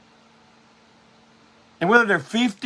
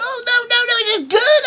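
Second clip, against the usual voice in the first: high falsetto mock praise, faking enthusiasm; hammed-up high voice pretending to love the cover. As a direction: gush over the art in a squeaky put-on voice. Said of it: "I'm telling him".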